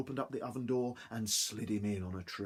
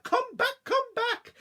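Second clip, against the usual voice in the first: very high, loud shriek; 7 dB louder, slower than usual. Acted. Wife chasing with her rolling pin, very high loud shriek. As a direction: screech after him. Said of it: "followed by the farmer's wife".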